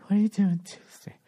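a hint of a coy, sultry ask; lightly voiced teasing wife; mock sexy wife voice. Husband mimicking his wife's coaxing, mock sultry. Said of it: "The sexy voice and then the pout".

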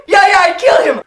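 put-on high, loud German-accented boast; excited hammy German shouting; gleeful shouting. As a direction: shout the triumph with manic glee. Pilot confirms the killing.

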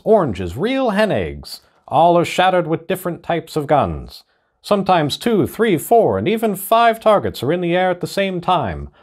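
high-pitched vintage radio announcer voice; old radio announcer. Acted. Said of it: "in the voice of an old radio announcer".

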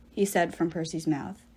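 plain reading voice

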